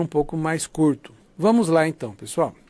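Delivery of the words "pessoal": normal talking voice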